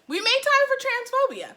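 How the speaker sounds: very high-pitched disbelief; high, appalled exclamation; incredulous repeat. Real, not acted. Incredulous repeat of her note.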